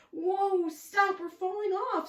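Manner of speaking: loud, high cry of alarm; panicked squeal. Acted. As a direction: yell as if falling off. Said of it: "squealed the cat and Frankenstein".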